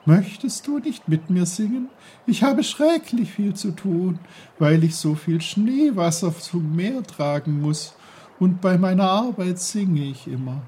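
high, loud, bubbly babbling voice; a merry stream calling out; cheerful brook. Acted. The brook greets Peter loudly and cheerfully.

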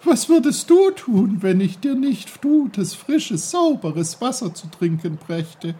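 high, loud, lecturing stream; the offended brook sets Peter straight; indignant brook. Acted. The brook lectures Peter on its important work.

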